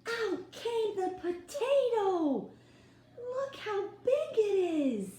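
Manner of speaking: high, loud and slow, full of wonder; excited reveal. A narrator's reading. Teacher reading the climax to children, high excited reveal. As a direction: build to the big moment.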